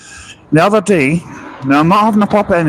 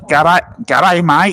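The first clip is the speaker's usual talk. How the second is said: put-on Australian accent, a bit higher, greeting a viewer; dodgy Aussie accent; 5 semitones higher, much slower than usual. Greeting Stephen Harris from Australia, dodgy Aussie accent. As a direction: ham up a cheery Aussie g'day. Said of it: "Sorry about the dodgy Aussie accent".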